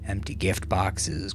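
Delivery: plain reading voice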